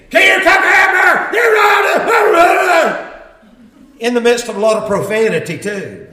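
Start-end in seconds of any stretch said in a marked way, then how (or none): 0.1-3.1 loud, much higher furious shout, dragged slow at the end; yelling boss. Retold or imitated. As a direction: scream it at a new hire in front of customers. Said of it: "yelling at me".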